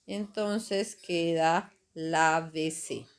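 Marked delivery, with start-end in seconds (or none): 1.9-3.1 lávese said slowly, weight on LÁ; slow lávese with the stress on the first A. Shown as a demonstration. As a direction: stretch out lávese, hitting LÁ. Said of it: "con el acento en la A".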